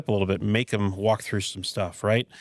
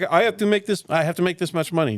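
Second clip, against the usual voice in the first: quick mock Scandinavian accent, pitched higher; 6 semitones higher than usual. Mimicking shop owners about money, mock Scandinavian accent. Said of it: "that was my Scandinavian accent".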